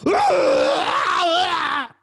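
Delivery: high, loud anguished yell; the guy howling in agony; man's yell. Retold or imitated. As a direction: cry out in pain. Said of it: "This guy's".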